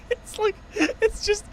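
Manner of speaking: very high, slow, laughing words; real breathless giggling; helpless laughter. Real, not acted. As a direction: squeak it out through laughter. Too amused to get words out.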